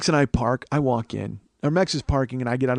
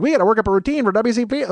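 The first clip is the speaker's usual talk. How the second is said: mock eager fans; 9 semitones higher, 6 dB louder than usual. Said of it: "they're like".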